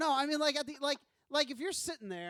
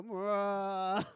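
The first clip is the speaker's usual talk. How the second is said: Welles mwah; 3 dB louder than usual. Retold or imitated. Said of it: "just call him and go".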